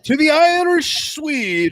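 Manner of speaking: high, slow hammed-up announcer call; high drawn-out emcee call; announcer intro. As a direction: announce the guest like a showman. Introducing guest with fanfare.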